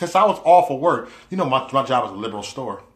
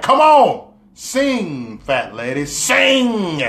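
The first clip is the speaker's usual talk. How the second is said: high, loud burst on the commands; impatient urging; 5 semitones higher, 5 dB louder, much slower than usual. Retold or imitated. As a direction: cheer and plead at once, stretching the key word. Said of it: "That's how I felt. I was like".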